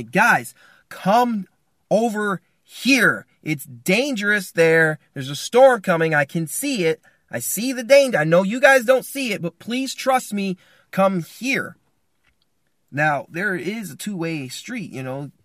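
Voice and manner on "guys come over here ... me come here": raised, higher pleading call; urgent calling out. Acted. Father calls his family to the cave, urgent pleading. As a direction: call them urgently out of danger. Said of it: "you're saying to your family".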